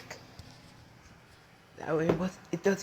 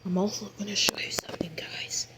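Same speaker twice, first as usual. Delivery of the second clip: hushed, breathy whisper, no voice; 2 semitones lower, 13 dB louder than usual. Real, not acted. Telling viewers to be quiet, then whispering the lesson. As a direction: keep it secret and quiet. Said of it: "gotta be very quiet".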